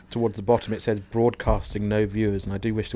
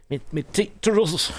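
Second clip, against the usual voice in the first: put-on Scottish accent; 8 semitones higher than usual. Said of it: "I stopped my Scottish accent".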